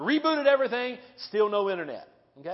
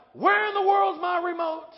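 grouchy husband; 9 semitones higher, 4 dB louder than usual. Pretend. Husband grumbling about remote.

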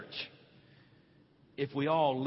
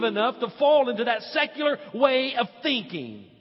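higher and louder, driving every phrase; preaching at full force; 9 semitones higher, 8 dB louder than usual. Acted. Pastor scolding the church for worldly thinking, full preaching force. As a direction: shout it out to the pews.

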